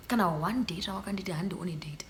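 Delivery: a hushed, whispered accusation; whispered complaint to the man. Real, not acted. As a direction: hiss it under your breath.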